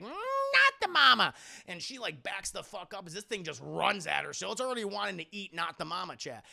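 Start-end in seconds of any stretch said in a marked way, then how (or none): 0.0-1.3 high, loud, drawn-out creature squeal; cartoonish high creature voice; baby creature. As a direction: squawk it like a bratty baby monster. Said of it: "the creature's like".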